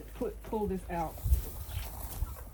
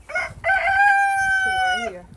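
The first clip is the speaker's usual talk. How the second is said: high, slow cry of effort; a long high groan of effort on the words; strained drawn-out cry; 8 dB louder, much slower than usual. Real, not acted. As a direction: stretch it out high as you heave.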